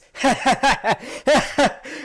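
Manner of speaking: high, loud cackle; mocking laugh. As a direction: laugh it off with scorn. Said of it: "your response is".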